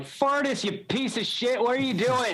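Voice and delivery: high-pitched mock-outraged call; hammy, high-voiced scolding; indignant calling out. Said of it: "Call him like".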